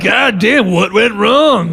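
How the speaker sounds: high, drawn-out exasperation; hammed-up puzzlement; mock bafflement. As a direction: act out being utterly mystified. Acts out own bafflement that night.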